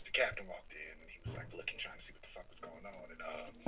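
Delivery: whispered, hushed retelling; whispering. Real, not acted. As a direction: drop to a whisper. Whispered story of captain.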